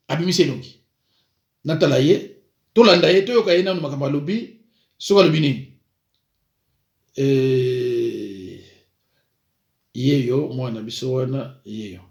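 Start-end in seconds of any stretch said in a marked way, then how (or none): none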